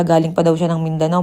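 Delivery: ordinary delivery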